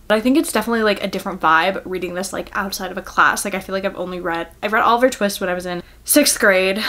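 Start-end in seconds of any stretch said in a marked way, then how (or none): none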